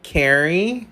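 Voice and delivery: slow, low Americanized drawl of Carrie; fake American accent. Kid mispronouncing kare-kare as Carrie to mother, slow put-on American accent. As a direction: stretch out Carrie like an American tourist.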